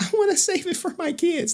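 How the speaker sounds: very high-pitched mock quote; mocking imitation. Streamer mocking a woman saving it for kids, high mimicry. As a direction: mimic her in a squeaky falsetto. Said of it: "She like".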